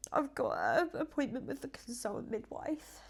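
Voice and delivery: breathy whisper, holding back tears; barely getting the words out through tears; tearful whisper. Real, not acted. Vlogger naming her next appointment, whispering through tears.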